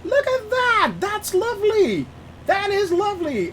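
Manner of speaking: very high-pitched delighted exclaiming; excited admiration. Real, not acted. Streamer showing viewers a lovely view, high excited delight. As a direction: marvel in a high squeal.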